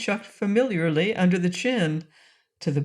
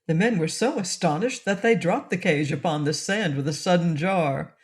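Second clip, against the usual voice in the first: plain reading voice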